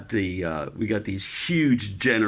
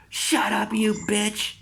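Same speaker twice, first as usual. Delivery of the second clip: higher, a hint of a rough chief; chief's insult; 10 semitones higher than usual. Chief telling the screaming sailor to shut up, rough. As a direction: snap it at him teasing. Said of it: "the chief goes".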